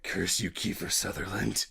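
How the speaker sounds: low, soft, rasping Snake curse; Solid Snake voice. Acted. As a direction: growl it quietly as Snake. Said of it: "remember our no impressions".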